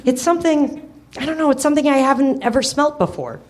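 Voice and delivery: high, eager pup voice; puppy voice. Acted. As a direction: voice a curious young puppy.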